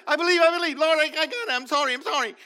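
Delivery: high, loud frantic confession; hammed-up Thomas begging; frantic pleading. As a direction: beg forgiveness in a rush. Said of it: "That's what Thomas is like".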